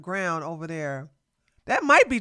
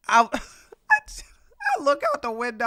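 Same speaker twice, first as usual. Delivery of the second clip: laughing; 3 semitones higher, slower than usual. Real, not acted. Laughing while telling.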